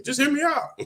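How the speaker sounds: squeaky high pleading repeat of "just hear me out"; pleading to be heard in a high squeak; mock falsetto plea. Shown as a demonstration. Squeaky falsetto "just hear me out" pleading with his viewers. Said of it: "and just hear me out".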